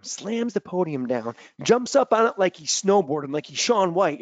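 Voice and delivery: ordinary talk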